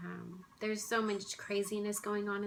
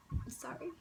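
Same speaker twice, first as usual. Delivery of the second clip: real grief catching in the throat; choked up apology; 10 semitones higher, 5 dB quieter than usual. Real, not acted. After recalling holding her ferret, a breaking apology. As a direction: voice cracks high and breaks off into tears. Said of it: "I'm still quite emotional about it".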